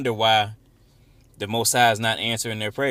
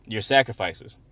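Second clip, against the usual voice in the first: usual speaking voice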